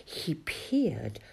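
ordinary delivery

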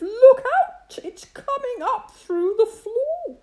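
high, loud panicked cry. A narrator's reading. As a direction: cry out in alarm. Animals panic at the noise.

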